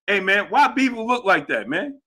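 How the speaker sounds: ordinary talk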